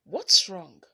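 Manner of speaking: loud, urgent yell. A narrator's reading. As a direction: shout down to someone in trouble. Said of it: "James yelled".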